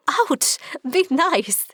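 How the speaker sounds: high, sudden yelp then giggly protest; yelping ouch. Acted. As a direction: yelp like you've been poked. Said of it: "I yelp and put a hand to my side, giggling".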